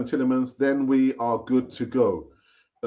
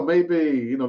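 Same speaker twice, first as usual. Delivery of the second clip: ordinary talk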